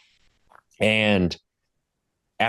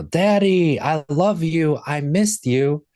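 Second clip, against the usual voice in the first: put-on kids yelling; 9 semitones higher, slower than usual. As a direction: imitate little kids running in shouting. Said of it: "and they yell".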